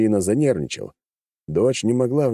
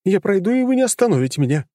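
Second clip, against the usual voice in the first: voiced as a stubborn, pushy daughter; high, insistent noblewoman; high-pitched daughter voice; 13 semitones higher, 4 dB louder than usual. A narrator's reading. Daughter defies father's guards.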